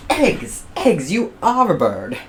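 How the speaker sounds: high, loud exclaim; excited discovery. Acted. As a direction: pounce on the clue. George excited.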